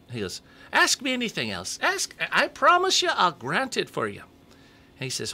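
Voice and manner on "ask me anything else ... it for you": very high squeaky voice pushing louder; high, loud pleading leprechaun. Acted. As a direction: insist like an eager little elf. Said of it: "great leprechaun voice".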